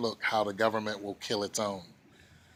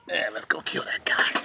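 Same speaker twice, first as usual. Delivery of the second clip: unvoiced mock whisper; 3 semitones higher than usual. Mocking officials planning a war, scheming whisper. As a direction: breathe the plan like a schemer.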